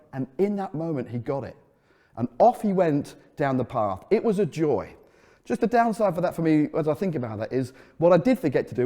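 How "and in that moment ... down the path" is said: normal talking voice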